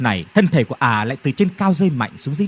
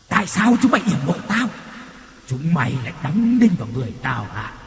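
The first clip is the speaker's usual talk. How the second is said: the wronged ghost lashes out; ghost raging. Acted. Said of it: "nghiến răng hậm hực". (hồn ma gầm lên giận dữ)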